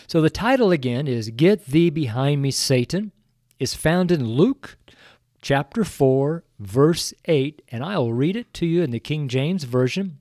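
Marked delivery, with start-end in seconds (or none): none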